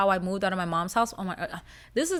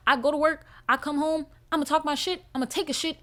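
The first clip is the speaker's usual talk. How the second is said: ordinary delivery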